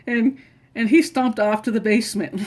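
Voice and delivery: ordinary talk, nothing marked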